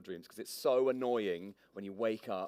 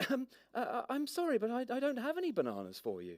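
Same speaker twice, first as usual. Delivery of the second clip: put-on much higher, hesitant young girl; meek girl voice. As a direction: stammer an apology timidly. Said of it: "She coughed meekly".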